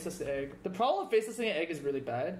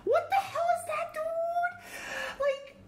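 shocked laughing disbelief; slower than usual. Real, not acted. Opponent's shocking play.

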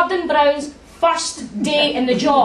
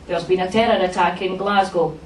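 lightly voiced Gordon Brown; 6 semitones lower than usual. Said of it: "He's like".

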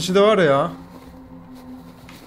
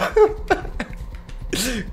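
high airy giggle; breathless laugh; 7 semitones higher than usual. Real, not acted. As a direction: laugh helplessly. Laughs at chase.

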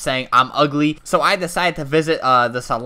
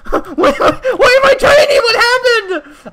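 high, loud mock crying wail; 7 dB louder than usual. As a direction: wail in fake despair. Avatar shrinks.

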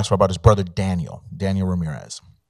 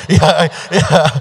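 laughing it out, higher and louder; amused laughing agreement; laughing yeah; 7 semitones higher, 7 dB louder than usual. Real, not acted. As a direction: laugh through the yeahs. Laughing after Tony's joke.

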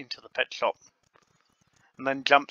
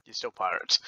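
usual speaking voice

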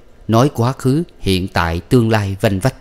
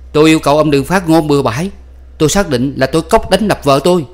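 higher, louder formal protest; indignant protest; 7 semitones higher, 4 dB louder than usual. A narrator's reading. Husband protesting to the adviser, indignant. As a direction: insist stiffly.

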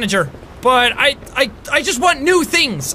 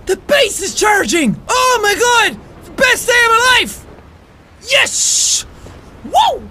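high, drawn-out whoops of excitement; overjoyed shouting; 9 semitones higher, slower than usual. Real, not acted. Gamer finds the base is charging, ecstatic. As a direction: celebrate like it's the best news ever.

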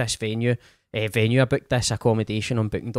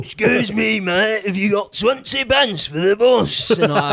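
loud, high, drawn-out pestering call; wheedling shout, stretched out; beggar accosting someone; 10 semitones higher, 5 dB louder, slower than usual. Retold or imitated. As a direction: call out high and slow for spare change. Acting out beggar calling out.

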